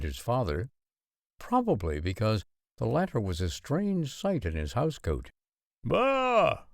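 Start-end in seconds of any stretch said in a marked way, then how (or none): 5.8-6.6 much higher startled bark of surprise; high startled exclamation; exclaimed. A narrator's reading. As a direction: blurt it out, caught off guard. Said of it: "one of them exclaimed".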